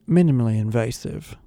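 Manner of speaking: put-on smooth, relaxed British accent; laid-back British cool. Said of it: "a painfully cool-sounding British accent".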